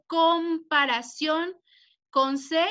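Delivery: "comparación" very slow, broken after "com"; spelling emphasis. Shown as a demonstration. Teacher pointing out the M P spelling, spelling emphasis. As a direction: draw out "comparación" to show the M before P. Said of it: "va con M P".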